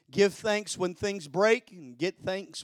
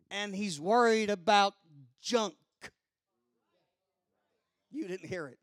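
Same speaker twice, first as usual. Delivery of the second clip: ordinary delivery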